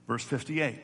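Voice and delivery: ordinary talk, nothing marked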